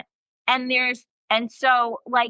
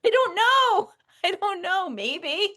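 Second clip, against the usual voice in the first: laughing; 9 semitones higher, slower than usual. Real, not acted. Giggly shrug.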